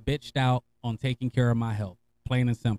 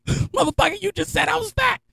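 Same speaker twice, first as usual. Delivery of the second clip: much higher, outraged comeback; mock indignant. Telling his homie off in high mock outrage. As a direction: play up being insulted.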